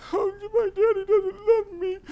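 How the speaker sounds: hammed-up high, loud little-girl wail; hurt child crying. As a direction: sob it slowly in a squeaky child voice. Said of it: "feeling hurt and devalued".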